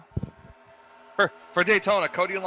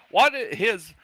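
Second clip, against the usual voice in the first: slow, louder, badly faked British; attempted British accent; 3 semitones higher, 3 dB louder, slower than usual. Teasing a co-host over his question, bad British accent. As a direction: imitate a co-host's British slip and fumble it. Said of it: "I can't do the accent".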